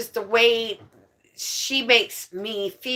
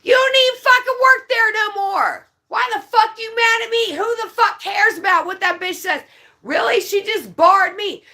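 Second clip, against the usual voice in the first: loud and high, furious at the bar owner; really fed up, shouting it out; angry rant; 10 semitones higher, 4 dB louder than usual. Real, not acted. Ranting to a friend about being barred, furious shout.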